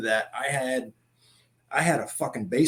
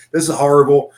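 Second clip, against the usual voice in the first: loud complaining this is horrible; mock yelling; 9 dB louder than usual. Recruits running in the snow, loudly complaining. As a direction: shout it like a miserable recruit. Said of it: "everybody's yelling".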